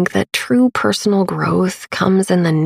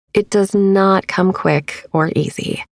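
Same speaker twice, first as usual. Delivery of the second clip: usual speaking voice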